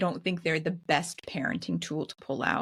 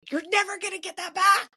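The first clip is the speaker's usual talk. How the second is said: pitched far higher, a mock flash of temper; lost temper; 13 semitones higher, faster than usual. Mother retelling snapping an ultimatum at her son, lost temper. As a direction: snap it out as if patience has run out. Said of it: "I totally lose my cool".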